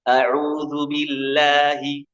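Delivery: A'udzu billahi sung slowly and clear, not through the nose; clean recitation. Shown as a demonstration. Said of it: "Beda dengan ini". (A'udzu billahi dilagukan pelan, bersih tanpa sengau)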